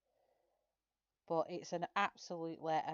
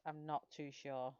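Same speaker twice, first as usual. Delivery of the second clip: normal talking voice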